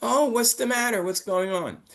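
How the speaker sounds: high-pitched concerned questioning; Caillou's grandma. Acted. Teacher quotes grandma from the dialogue, high animated grandma voice. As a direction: lift the voice high, like a storybook grandma. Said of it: "where Caillou's grandma says".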